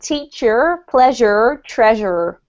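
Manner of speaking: teacher, pleasure, treasure with a strong final R; American accent demo, R sounded at the ends. Shown as a demonstration. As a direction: roll the endings into a clear American R. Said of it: "if you're from the U S A or you want to imitate that accent".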